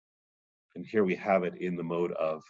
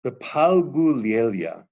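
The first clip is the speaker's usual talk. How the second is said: slow, louder intoned Aramaic; solemn recitation; 4 semitones higher, 6 dB louder, slower than usual. Acted. Teacher reading the Aramaic aloud, solemn intoning. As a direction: intone it like scripture.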